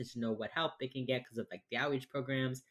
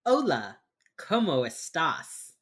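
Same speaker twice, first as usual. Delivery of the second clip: put-on loud, slow American-accented Spanish; 4 dB louder, much slower than usual. Imitating a character's bad Spanish on a call, heavily American accent.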